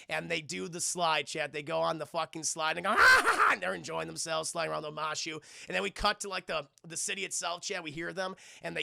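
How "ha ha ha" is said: loud, high mock laughing; imitated gleeful laughter. Friends enjoy the slide together, high gleeful laughter. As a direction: whoop out laughs as if sliding.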